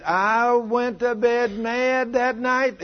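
high, very drawn-out retelling; acted indignation. Preacher quoting husband who went to bed mad, acted indignation. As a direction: drag every word out high, mock indignant. Acting out man's angry confession.

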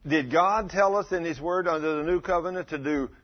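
ordinary delivery

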